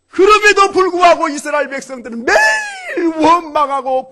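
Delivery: high, loud, impassioned cry; full-force preaching. Real, not acted. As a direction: stretch key words into a soaring shout.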